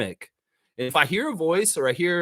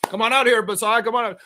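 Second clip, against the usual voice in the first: calling him out; 8 semitones higher, 4 dB louder than usual. Pretend. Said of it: "proud to announce".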